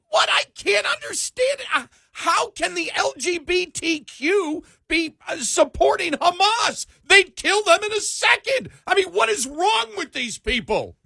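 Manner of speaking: high shouting rant, slow; Independent Bob. Retold or imitated. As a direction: yell it like an outraged old guy. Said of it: "now I have to do this in the Independent Bob style".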